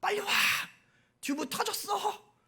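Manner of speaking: put-on high, shrill-pitched cries of a drowning girl; acted panic yell from the water; panicked scream for help. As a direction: scream for rescue, pitch flying up. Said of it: "막 소리를 지릅니다".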